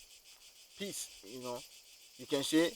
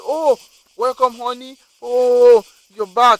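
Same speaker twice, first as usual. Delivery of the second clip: higher-pitched wife greeting her husband; acted delighted welcome; 6 semitones higher, 6 dB louder, slower than usual. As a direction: exclaim it like a surprised homecoming welcome. Wife greets returning husband in story.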